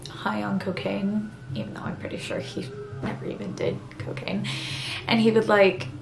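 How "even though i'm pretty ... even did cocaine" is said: hushed aside under the breath; whispering. Real, not acted. Whispered aside mid-story.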